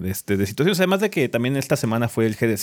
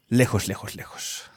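fading echo; 3 semitones lower, 5 dB quieter, slower than usual. Acted. Dramatic fading echo on lejos.

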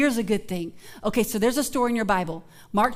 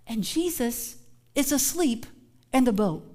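ordinary talk, nothing marked

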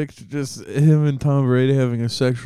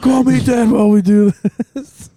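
higher, louder, hammed-up creepy demand; 10 semitones higher, 7 dB louder than usual. Cohost plays creepy Brady, now a higher, louder mock demand. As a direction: push it up loud and leering. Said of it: "creepy Brady role".

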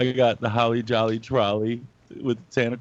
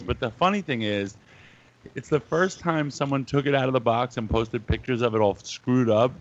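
ordinary talk

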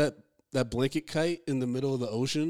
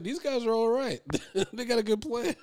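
higher, light approving voice; impressed recruit; 9 semitones higher than usual. Retold or imitated. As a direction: sound won over by the new crew. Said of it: "He's like".

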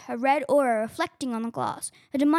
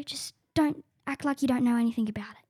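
normal talking voice

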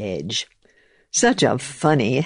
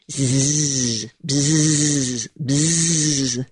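bee voice; much slower than usual. Acted. Bumblebee buzzes.

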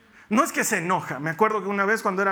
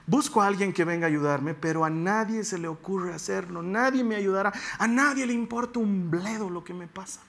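scripture reading. Acted. Reads psalm lament.

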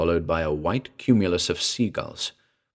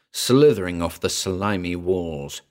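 ordinary delivery, nothing marked

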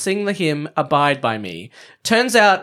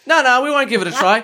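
higher, exasperated official; mock character voice; 8 semitones higher than usual. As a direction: play the pompous official refusing. Same character refusing.

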